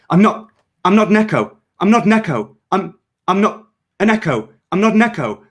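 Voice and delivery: high, loud, repeating frantic denial; stuck on the same plea; panicked looping denial. A narrator's reading. Doomed cleanser looping his denial before the shot, frantic. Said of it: "his panicked face settled into a loop".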